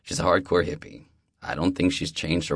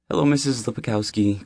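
polite; 6 semitones higher, 5 dB louder than usual. Acted. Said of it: "Kai says politely".

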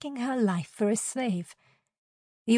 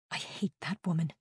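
seething; 2 semitones lower, 8 dB quieter than usual. A narrator's reading. Seething once the tormentor leaves.

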